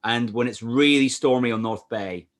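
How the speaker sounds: ordinary talk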